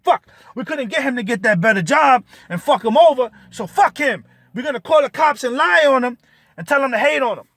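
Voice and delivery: high, loud mocking voice of the plotters; sneering caricature of angry officials. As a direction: ham up their frustrated scheming.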